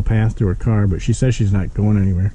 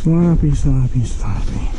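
playful repetition; 4 semitones higher, slower than usual. Real, not acted. Repeats sloppy fading to whisper.

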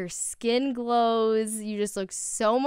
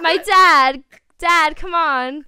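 put-on Jersey accent; 7 semitones higher, 9 dB louder, slower than usual. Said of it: "an England accent".

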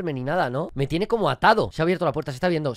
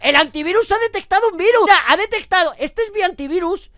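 high, loud alarmed outburst; real alarm, higher and louder; alarmed shout; 11 semitones higher, 7 dB louder than usual. Real, not acted. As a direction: sound the alarm about the virus. Antivirus alarm, alarmed start.

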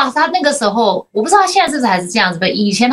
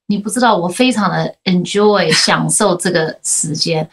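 lower, slowed-down fond father voice; tender father; 6 semitones lower than usual. Retold or imitated. Father telling his daughter he enjoys polishing her shoes, lower and slow. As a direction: savour each word like a doting dad. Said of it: "然后他就说".

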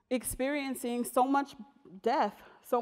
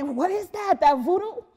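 loud, higher-pitched mock disbelief; incredulous onlooker; 6 semitones higher, 7 dB louder than usual. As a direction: imitate a baffled passer-by, pitched up and loud. Acting out people's reactions to work.

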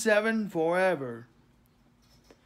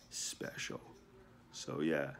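whispering; 17 dB quieter than usual. Real, not acted. Trails into a whisper.

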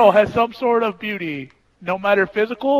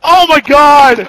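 loud outburst; 6 semitones higher, 16 dB louder, much slower than usual. Real, not acted. Gamer reacting in match.

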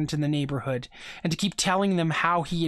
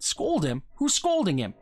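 indignant; 3 semitones higher than usual. A narrator's reading. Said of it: "Dave said indignantly".

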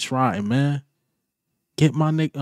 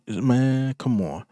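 usual speaking voice

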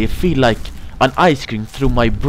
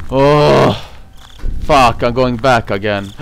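ordinary delivery

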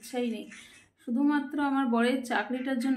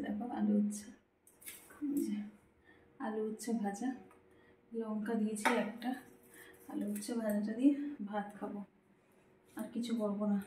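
softly, keeping the voice down; hushed talk; 8 dB quieter than usual. Real, not acted. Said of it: "খুব আস্তে আস্তে কথা বলছি".

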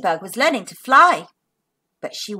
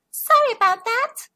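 higher bug voice, sheepish; small ladybug voice; ladybug apologizing; 11 semitones higher than usual. Acted. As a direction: say sorry like a tiny bug. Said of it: "she gasped".

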